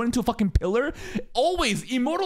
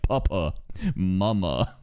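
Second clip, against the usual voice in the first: much lower, deep man voice; mock deep villain voice; Kaiba's voice; 13 semitones lower, slower than usual. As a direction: say it like a stern grown man. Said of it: "saying Kaiba's voice".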